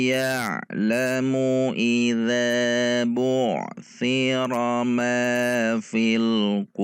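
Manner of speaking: measured Quranic chant; Quran recitation. Shown as a demonstration. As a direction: recite it melodically, drawing out vowels.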